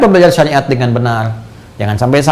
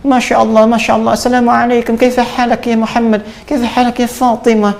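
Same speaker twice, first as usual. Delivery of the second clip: put-on Arabic accent; 9 semitones higher than usual. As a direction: show off a native Arabic accent.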